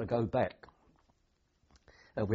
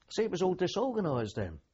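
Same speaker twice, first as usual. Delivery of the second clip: usual speaking voice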